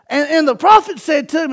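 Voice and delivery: ordinary talk, nothing marked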